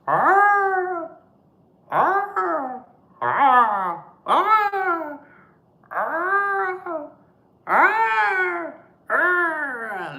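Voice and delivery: high loud squawking bird cry; repeated high squawking arr; bird call. Retold or imitated. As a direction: caw it out like a seabird at night. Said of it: "they go".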